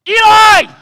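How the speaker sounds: a loud, high yell across the dark house; shouted call. Acted. Samuel shouting for Eli from his bed.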